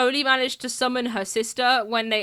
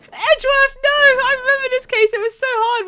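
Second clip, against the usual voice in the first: much higher, louder Edgeworth no; hammed-up despair at the memory; mock anguish; 11 semitones higher, 5 dB louder than usual. Real, not acted. Dramatic flashback reaction.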